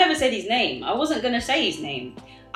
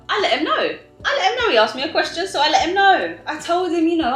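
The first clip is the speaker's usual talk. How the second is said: excited; 9 semitones higher, 4 dB louder, faster than usual. Real, not acted. Excited emphatic retelling.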